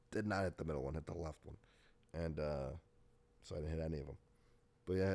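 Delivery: ordinary talk, nothing marked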